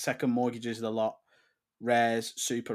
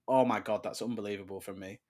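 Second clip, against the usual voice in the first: normal talking voice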